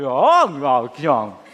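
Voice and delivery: normal talking voice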